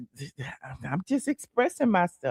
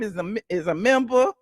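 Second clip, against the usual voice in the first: ordinary talk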